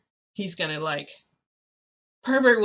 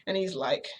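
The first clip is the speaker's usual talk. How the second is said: ordinary delivery, nothing marked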